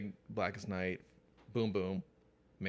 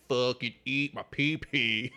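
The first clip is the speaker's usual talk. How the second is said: mock crude fan; 3 semitones higher, 6 dB louder, slower than usual. Mock fan insulting Jim Lee.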